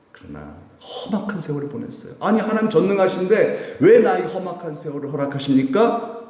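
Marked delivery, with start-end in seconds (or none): 2.2-6.2 high, indignant questioning of God; mock indignant complaint. Believer challenging God over hardship, high indignant complaint. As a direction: demand an answer from God, half-mocking.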